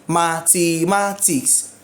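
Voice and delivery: MATHEMATICS drawn out slowly in four beats; slow count through the syllables of MATHEMATICS. Shown as a demonstration. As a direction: split MATHEMATICS into separate syllables. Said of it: "has four syllables".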